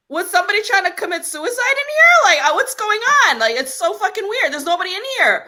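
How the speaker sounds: high-pitched, bewildered rant; freaked-out disbelief. Retold or imitated. Renter reacting to the oven on in an empty apartment, freaked-out disbelief. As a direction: pitch it way up, baffled and alarmed.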